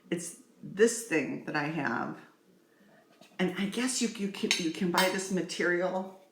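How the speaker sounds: normal talking voice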